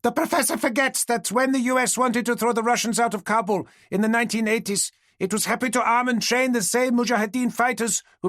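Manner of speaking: a heckler shouting up at the panel, high and shrill. Acted. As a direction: call it out high and sharp over the room. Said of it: "called out shrilly".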